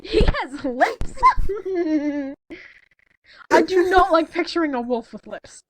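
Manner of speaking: usual speaking voice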